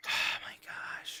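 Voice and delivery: slow whispered sigh; exasperated whisper. Real, not acted. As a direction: whisper it, worn out.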